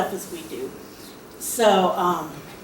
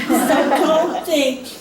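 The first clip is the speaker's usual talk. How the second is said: laughing, slow; 4 semitones higher, 4 dB louder, much slower than usual. Real, not acted. Laughs after hat story.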